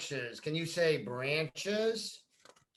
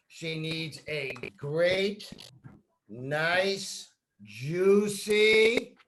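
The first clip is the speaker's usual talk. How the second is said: put-on reading to children. Teacher reading a picture book to a child, lively and expressive. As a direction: read it like a bedtime picture book.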